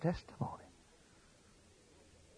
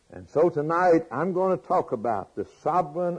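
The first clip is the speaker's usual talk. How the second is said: normal talking voice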